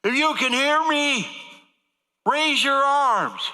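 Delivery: high, loud and drawn out; mock doctor yell. Doctor shouting commands into a coma patient's ear. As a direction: bellow each word slowly at a patient. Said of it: "As loud as he's screaming in my ear".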